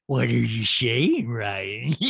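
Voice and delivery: slurred, slow mock-celebrity voice; slushy, drawn-out caricature of a reality star; Lisa Rinna. Host mimics reality star challenging him about her absence, slurred caricature. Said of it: "it's so funny with the Rinna voice".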